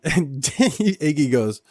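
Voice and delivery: laughing, slow and high. Real, not acted. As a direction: laugh through it. Laughing while telling.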